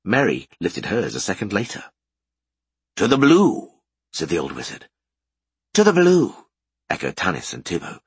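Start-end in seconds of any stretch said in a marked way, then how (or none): 3.0-3.7 louder solemn toast. A narrator's reading. Wizard raises a toast. 5.7-6.3 higher, louder chorus reply; echoed toast. A narrator's reading. Others echo toast.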